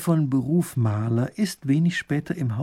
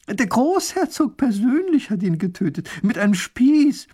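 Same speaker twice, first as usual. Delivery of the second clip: high, emphatic gossip; thrilled revelation; 11 semitones higher, 6 dB louder than usual. Acted. Wife revealing the dragon slayer to neighbour, thrilled. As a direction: deliver the big news, pitched way up.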